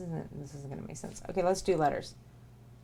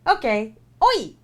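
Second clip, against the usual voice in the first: louder, mocking oi; mock villain oi; 9 semitones higher, 8 dB louder than usual. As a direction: sneer out a teasing oi.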